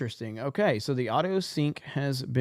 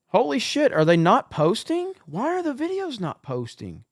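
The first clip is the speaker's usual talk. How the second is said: shocked; 8 semitones higher, 6 dB louder than usual. Real, not acted. Discovers videos are not uploading.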